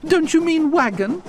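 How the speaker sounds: two chattering carriages asking, baffled; puzzled carriages. A narrator's reading.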